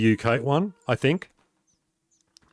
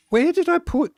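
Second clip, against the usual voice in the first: very high, squeaky-pitched alarm. Real, not acted. Streamer can't find the glass in the game, real high-pitched alarm. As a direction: suddenly realise it's missing, pitch shooting up. Searching for a lost item.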